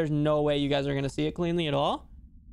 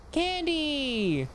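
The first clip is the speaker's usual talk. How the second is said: gasping, high and delighted; excited gasp; 8 semitones higher than usual. Real, not acted. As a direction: discover the candy with a gasp. Gasps at finding candy.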